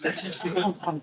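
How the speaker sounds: a short real laugh; light chuckle; amused laugh. Real, not acted. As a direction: laugh at your own line. Laugh after quote.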